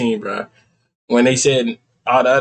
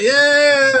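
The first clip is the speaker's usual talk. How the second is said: excited yell; 12 semitones higher, 3 dB louder than usual. Retold or imitated. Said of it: "I'm like".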